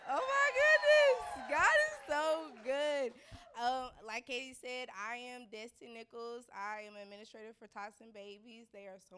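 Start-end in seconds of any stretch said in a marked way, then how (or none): 0.1-3.2 high, loud burst of praise; thrilled church praise, slowed; excited exclamation. Real, not acted. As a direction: gush with joy at the start. Excited praise opening a testimony.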